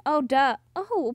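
ordinary talk, nothing marked

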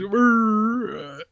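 high, drawn-out strained groan; mock a goofy, can't-help-it grimace noise; Jeff Bridges. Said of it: "looks like he's making that sound".

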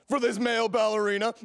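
scornful line breaking into a laugh; jeer, then laugh at the rival; mocking laughter. Rival mocks the boyfriend's job.

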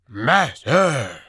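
loud call, consonants still blurred; wolf creature calling. A narrator's reading. False wolf calls again for the sorcerer, loud. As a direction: shout the word, clearer this time. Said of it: "it called".

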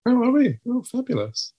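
high delighted surprise; high-pitched surprised delight at the news; mock delight. Real, not acted. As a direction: squeal it up high, thrilled. Hearing podcast ranking.

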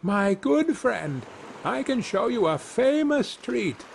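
high-pitched hail to a stranger; calling out. A narrator's reading. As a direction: call out in a high, friendly storybook voice. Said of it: "he called out".

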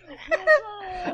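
high crying laugh; laughing fit. Real, not acted. Host cracks up at the cohost's impression. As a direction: burst out laughing.

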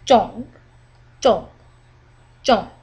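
"zhong" short, plunging high to low; falling tone. Shown as a demonstration. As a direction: fire off "zhong" with a sharp drop. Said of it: "It goes from high to low".

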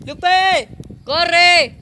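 very loud high shout. Real, not acted. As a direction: yell across the house. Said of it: "le he dicho a mi sobrina que le corriera".

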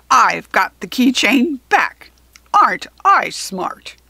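loud, slow, self-satisfied boasting; gloating goblin. A narrator's reading. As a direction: crow triumphantly like a smug goblin. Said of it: "the goblin gloated triumphantly".

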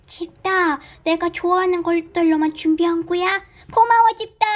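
high, bright toy bunny voice; cute bunny voice. Pretend. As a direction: play the little bunny, high and sweet. Toy bunny Mibong speaks.

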